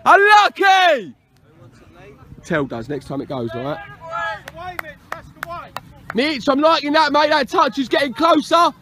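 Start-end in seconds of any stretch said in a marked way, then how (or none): 0.0-1.1 slow, stretched-out touchline call; drawn-out coach's shout; encouraging shout. Real, not acted. Coach calls encouragement across pitch. 2.4-3.8 low, soft instruction; hushed, dropped voice; quiet aside. Real, not acted.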